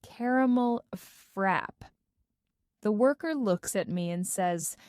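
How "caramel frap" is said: slow, deliberate "Caramel frap"; careful first try. Acted. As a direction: say "Caramel frap" slowly, each word placed. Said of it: "I take a deep breath and say".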